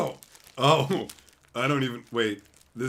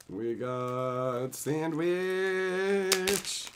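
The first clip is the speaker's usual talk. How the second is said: slow silly voice; 3 semitones higher, much slower than usual. Pretend. Very slow odd voice.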